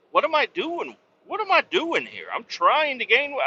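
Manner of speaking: high, incredulous self-reproach; exasperated self-scolding. Retold or imitated. Trucker mocking himself for gaining weight, high exasperated disbelief. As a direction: cry out at yourself in disbelief.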